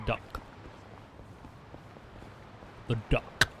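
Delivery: DUCK with a hard, popped final C; crisp, over-pronounced CK on DUCK; strong hard C. Shown as a demonstration. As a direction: hit the K sound ending DUCK. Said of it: "gotta pronounce that C strongly".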